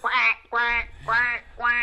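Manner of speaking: loud playful duck quacks. Pretend. Cohost joins quacking.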